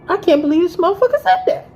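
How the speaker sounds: high, fast, loud outraged cry; can't believe what he said; furious disbelief. Retold or imitated. About her boyfriend's cruel remark, outraged and high. Furious thought while hitting him.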